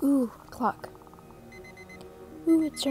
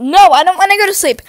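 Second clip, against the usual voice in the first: loud protest; 2 semitones higher, 8 dB louder than usual. Real, not acted. Protesting bedtime in the game.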